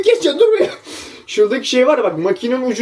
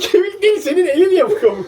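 laughing; 10 semitones higher than usual. Real, not acted. Laughing retort.

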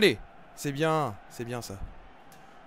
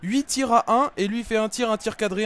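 loud and high, counting the shots in outrage; worked-up rant; 6 semitones higher, 10 dB louder than usual. Real, not acted. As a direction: protest the unfairness at full voice. Opponent scores despite fewer shots.

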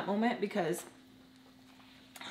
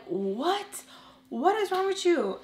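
much higher, louder outburst; can't believe what you heard; shocked disbelief; 10 semitones higher, 3 dB louder than usual. Retold or imitated. Friends shocked at her answer.

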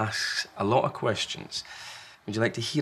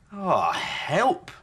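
higher, mock-flustered cry; Large, flustered parent; 9 semitones higher, 4 dB louder than usual. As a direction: play stumped for a moment. Large caught out.

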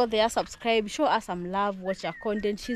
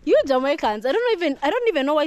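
excited teasing; 6 semitones higher, 7 dB louder than usual. Real, not acted. Teasing friend over Jamaica answer.

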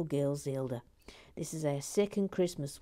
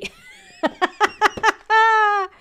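loud high laugh; 14 semitones higher, 12 dB louder than usual. Real, not acted. Host laughs at her own New York joke, loud and high.

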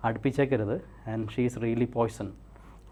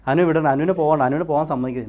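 loud, raised-pitch urging; urgent command; 5 semitones higher, 10 dB louder than usual. Real, not acted. Hypnotist urging subject not to let Anu go, loud urgent command. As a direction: press the order home forcefully.

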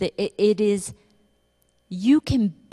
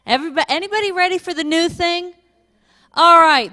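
ordinary talk, nothing marked

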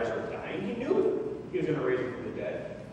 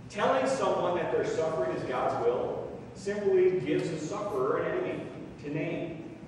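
usual speaking voice

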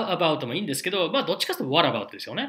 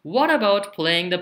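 What about playing the piano asked higher and louder as a model line; teacher example, raised; 6 semitones higher, 6 dB louder, slower than usual. Shown as a demonstration. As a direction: demo question with a lifted voice. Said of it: "じゃあこれを".

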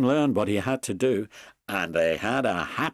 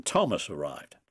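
ordinary delivery

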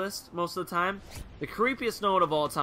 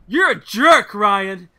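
much higher, booming mock accusation; imitated loud outburst; 3 semitones higher, 12 dB louder, much slower than usual. Friend [name] breaks the awkward silence, loud mock outrage. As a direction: blurt it out loudly into a dead silence.

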